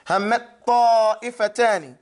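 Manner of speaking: "Hammat ta'ifatani" slow with the first t held into the second; doubled ta, slow. Shown as a demonstration. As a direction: drag "Hammat ta'ifatani," one long doubled t. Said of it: "When you don't pronounce the ta".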